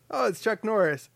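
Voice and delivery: surprised recognition. Retold or imitated. Retelling bumping into Chuck Norris, straightforward surprise. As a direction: spot a celebrity face to face. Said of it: "Well, no, it's more like".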